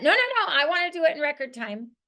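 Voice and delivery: lightly voiced go-getter begging; eager recruit insisting. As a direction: insist like someone who wants it now. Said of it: "I want them to beg me for it".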